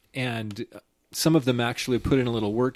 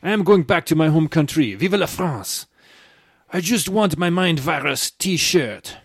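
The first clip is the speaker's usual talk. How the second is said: hammed-up French accent, pitched higher and louder; 5 semitones higher, 5 dB louder than usual. Host reading a listener's comment, in mock French accent. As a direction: read it like an indignant Frenchman in a thick French accent. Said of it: "In my French accent".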